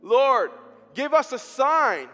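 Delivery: pleading prayer. Retold or imitated. Parents praying at night for their son, pleading loudly. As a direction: beg the Lord out loud for a sign.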